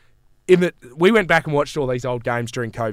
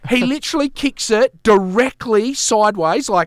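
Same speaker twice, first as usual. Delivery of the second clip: slow, much higher disbelief; incredulous outrage; 7 semitones higher than usual. Retold or imitated. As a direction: stretch it out in disbelief. Outraged at the blunder.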